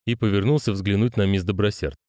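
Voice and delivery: ordinary delivery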